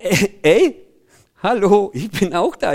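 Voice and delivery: acted "hey, over here" call; calling for attention. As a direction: call out to people ignoring you. Healed man calls to ignoring crowd. (gespieltes Rufen um Aufmerksamkeit)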